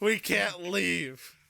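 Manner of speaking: high, loud, drawn-out cry; mock desperate wail. Players stuck in the cave, mock desperate wailing. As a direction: stretch it into a hammed-up lament.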